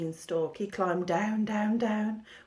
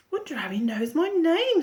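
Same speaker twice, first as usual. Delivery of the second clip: Jack's thought; 8 semitones higher, 3 dB louder than usual. A narrator's reading. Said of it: "thought Jack".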